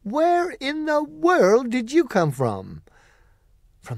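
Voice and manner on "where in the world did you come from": put-on high, slow old aunt crying with relief; overjoyed aunt greeting her lost girl. Aunt Em sees Dorothy running home, high joyful cry.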